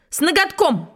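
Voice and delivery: high-pitched, emphatic announcement; triumphant declamation. Acted. Schoolboy who suddenly remembered the title, declaiming in triumph. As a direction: blurt it out proudly as the missing answer. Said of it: "сразу вспомнил заглавие и сказал".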